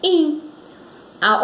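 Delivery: ordinary talk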